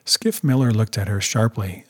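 usual speaking voice

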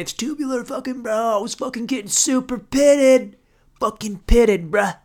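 stoked surfer bro voice. Pretend.

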